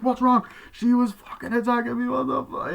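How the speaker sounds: high, loud frantic words; panicked. Retold or imitated. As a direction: blurt it out in a panic. Panicked retelling of attack.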